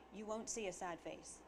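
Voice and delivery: ordinary talk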